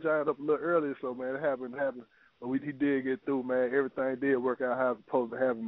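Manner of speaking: ordinary talk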